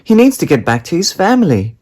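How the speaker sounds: put-on high, loud urgent girl; pleading child. A narrator's reading. Daisy pleading with Mum, high and urgent.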